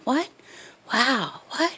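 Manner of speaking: soft, half-whispered amazement; under his breath. Retold or imitated. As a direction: mutter it in quiet wonder. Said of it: "under his breath".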